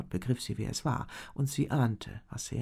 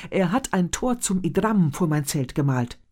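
louder, vehement accusation; heated; 5 semitones higher, 9 dB louder than usual. A narrator's reading. Elena accuses the shaman before the chief, heated. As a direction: press the charge hard. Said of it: "erklärte ihm Elena heftig".

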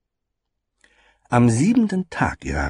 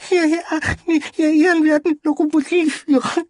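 very high, loud tearful little voice; sobbing child; 6 dB louder than usual. Acted. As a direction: sob it out as a tiny child. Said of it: "schluchzte er".

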